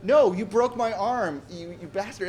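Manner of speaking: retold anger. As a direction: protest indignantly, stretching the words. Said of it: "I was still mad about it".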